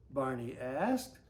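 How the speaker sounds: usual speaking voice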